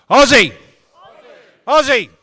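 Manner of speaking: high, full-force chant call; loudest possible shout. Real, not acted. Leading new citizens in an Aussie chant, shouting. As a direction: belt it out for the crowd to answer. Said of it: "In your loudest possible voice".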